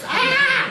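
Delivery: very high sped-up "ah"; high-pitched squeal. Shown as a demonstration. Teacher demos the sped-up recording, high squeal. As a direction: squeal it at the top of the voice. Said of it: "this would sound like this".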